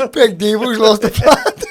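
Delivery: loud, high laughter through words; slow, howling with laughter; laughing hard. Real, not acted.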